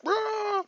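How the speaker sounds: high, drawn-out "rah" yell; mock battle roar of something charging at you. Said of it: "dark figure just yelling".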